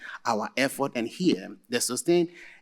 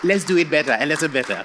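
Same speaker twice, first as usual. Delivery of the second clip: louder and higher, pumping up the room; rallying the crowd; 5 semitones higher, 8 dB louder than usual. Real, not acted. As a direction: call out to the audience to clap harder. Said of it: "I mean, a little louder".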